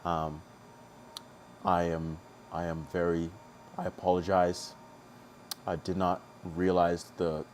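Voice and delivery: hushed and overly solemn, mock contrition; YouTube apology. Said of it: "let me do my YouTube apology".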